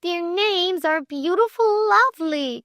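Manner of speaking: put-on high-pitched girlish protest; high, insistent correction; crying out. A narrator's reading. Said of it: "cried Flame".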